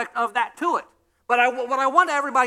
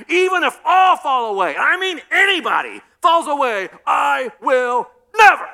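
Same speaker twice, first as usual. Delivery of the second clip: boastful shout; 6 semitones higher, 8 dB louder than usual. Retold or imitated. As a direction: shout the promise proudly. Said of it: "Peter said".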